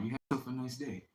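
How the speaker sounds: usual speaking voice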